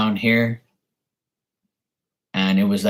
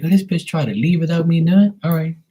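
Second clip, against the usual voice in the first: indignant; 8 semitones higher than usual. Retold or imitated. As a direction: react like you just caught her sneaking off. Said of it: "I'll be like".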